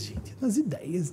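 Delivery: whispered and slow, gente nas ideias; confiding whisper. Real, not acted. Speaker stressing ideas to the audience in a slow whisper. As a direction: lean in and whisper the point. Emphasising ideas over accent.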